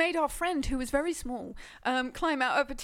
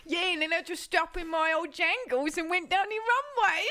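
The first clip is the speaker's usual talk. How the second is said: higher, hammed-up Cockney patter; mock Cockney; 5 semitones higher than usual. Playing the supermodel recalling the catwalk, exaggerated mock Cockney. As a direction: play the model as pure Cockney. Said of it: "Pure Cockney".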